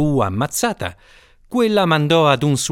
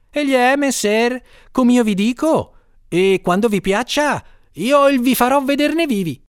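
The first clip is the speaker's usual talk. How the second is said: higher, pleading servant voice; servant Chichibio; 11 semitones higher than usual. A narrator's reading. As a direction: insist nervously to your master. Servant insisting.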